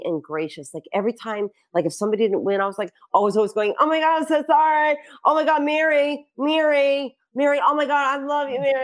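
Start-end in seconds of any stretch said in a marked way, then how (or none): 3.8-8.7 high-pitched effusive consoling; gushing sympathy. Retold or imitated. Host consoling a contestant who didn't win, gushing sympathy. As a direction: gush over them in a raised pitch.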